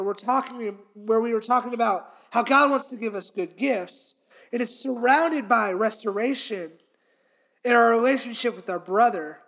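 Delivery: ordinary talk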